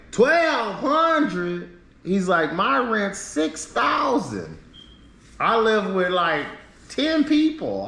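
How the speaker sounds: incredulous squeak of a stranger; shocked disbelief. Retold or imitated. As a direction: play the guy gasping at how cheap your rent is. Guy shocked by low rent.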